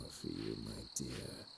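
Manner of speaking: soft, low, menacing purr; low unhurried voice. Acted. Sinister character softens.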